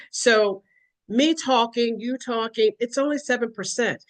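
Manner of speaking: ordinary talk, nothing marked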